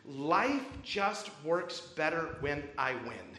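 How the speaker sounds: slowly, each word spelled out; slow confession. Retold or imitated. Staffer admitting why he yelled, slow and deliberate. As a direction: let it drop slowly, word by word. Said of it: "He just goes".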